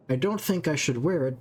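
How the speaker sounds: usual speaking voice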